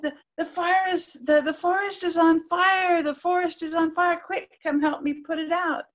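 high, breathless urgent warning; alarmed sparrow. Acted. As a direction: stumble over the words in alarm.

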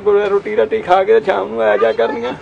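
telling his hardship through tears; real weeping as he speaks; crying while talking. Real, not acted. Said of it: "ਰੋ ਨਾ ਬਾਈ".

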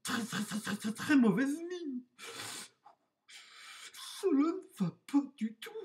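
high, shivering wolf stammer; put-on high voice, juddering with cold; teeth chattering. A narrator's reading. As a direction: stutter as if freezing after an icy bath. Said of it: "En claquant des dents".

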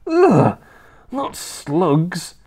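put-on high, revolted little boy; disgusted cry. A narrator's reading. George hearing he must eat slugs, revolted. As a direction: cry out in horror as a small boy. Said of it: "George cried out".

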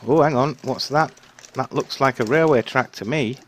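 rising panic, run for it; being chased. Real, not acted. Sees track, flees in game.

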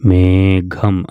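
മേഘം drawn out very slowly with a clear aspirated ഘ; very slow careful reading. Shown as a demonstration. Teacher tells pupils to notice the ഘ, reading slowly. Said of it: "ഘ എന്നുള്ളത് അവിടെ നല്ലോണം ശ്രദ്ധിക്കണം".

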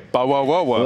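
mock British "Bawa Wawa", low; "Bawa Wawa" lightly mocking British; British parody. Shown as a demonstration. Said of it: "whenever I really wanna parody it".

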